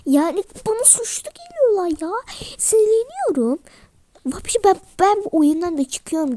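a kid quitting the pretend game in a huff; fed-up outburst. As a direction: storm out of the game, voice up high. Child storms out of the game.

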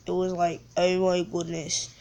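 slightly low put-on British accent. Gamer gushing over own shot, mock British accent. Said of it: "talking in a British accent".

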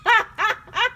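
talking through real laughter; amused at her own slip, laughing; laughing, embarrassed at herself. Real, not acted. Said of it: "gotta laugh at myself".

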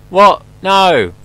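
sudden high shout of alarm; startled yelp. Real, not acted. Startled gamer yelps at a sudden scare in the dark. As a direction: jump out of your skin and yell.

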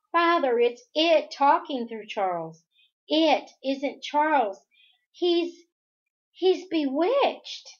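high, loud urgent plea; desperate insistence. A narrator's reading. Daughter warns father her brother is bewitched, desperate. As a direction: push hard to be believed, rising as you go.

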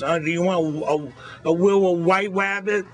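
high, teasing wabbit talk; mocking the girl's lisp. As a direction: play along with the kid's lisp, sing-song. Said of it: "He goes".

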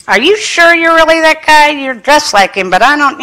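높은 목소리로 따지듯 묻기; 의심 가득 높게 치켜든 질문; mock challenge. Retold challenging God's messenger.

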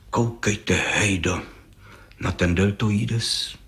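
slow, low, quiet appraisal; hushed admiration. Acted. Judge shows Hejda the muscles, quietly admiring. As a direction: point out the muscle in an undertone.

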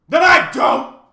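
huge high shout; thundering. A narrator's reading. Math magician refuses Tok, thundering shout. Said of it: "he thundered again".

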